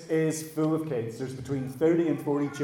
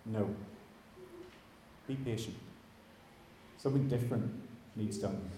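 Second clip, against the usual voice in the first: calm divine answer; 3 semitones lower, 11 dB quieter than usual. Retold or imitated. God answers calmly.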